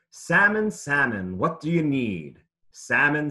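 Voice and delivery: normal talking voice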